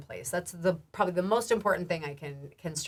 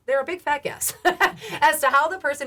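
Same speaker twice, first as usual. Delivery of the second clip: laughing; 7 semitones higher, 5 dB louder than usual. Real, not acted. Laughing at her own phrase.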